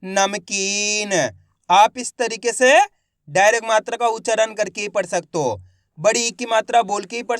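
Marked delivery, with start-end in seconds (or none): 0.0-1.1 नमकीन very slow, long-held kee; blended word demo. Shown as a demonstration. Teacher joining letters for pupils, नमकीन drawn out. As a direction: stretch नमकीन by syllable. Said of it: "तो मिलके बन जाएगा".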